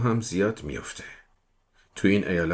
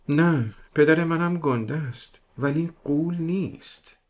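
put-on higher and slow, childlike; earnest small girl, slowly explaining; girl tells the detective her father isn't a giant, slow and high; little girl voice; 9 semitones higher, slower than usual.

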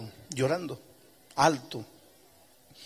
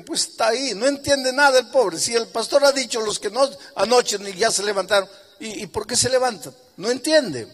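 mocking crowd; 8 semitones higher, 3 dB louder than usual. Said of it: "porque dijeron".

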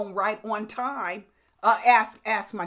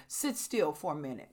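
ordinary talk

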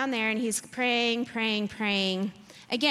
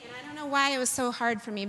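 usual speaking voice

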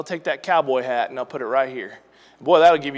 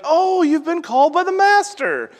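high, sing-song fawning crowd voice; mock crowd; 12 semitones higher, 4 dB louder than usual. The crowd now flatters the beggar, in a high mocking sing-song. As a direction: gush like a suddenly friendly crowd. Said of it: "everybody's like".

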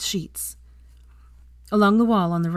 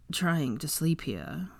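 slow, soft sleepy grumble; understated grouse from a half-asleep man; grousing; 6 dB quieter, much slower than usual. A narrator's reading. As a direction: grumble it drowsily, drawn out. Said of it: "Draco groused at his friend".

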